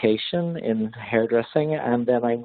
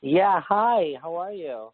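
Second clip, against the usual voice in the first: far away; 6 semitones higher, slower than usual. Real, not acted. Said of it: "you sound so far away".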